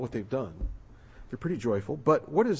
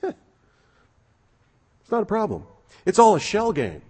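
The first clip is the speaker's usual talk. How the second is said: mock carefree; 6 semitones higher, much slower than usual. Mocking careless debtor attitude.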